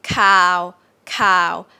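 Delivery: "cow" held flat and monotone; mid tone. Shown as a demonstration. Teacher models the Thai midtone, flat "cow". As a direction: say "cow" level and long, no up and down.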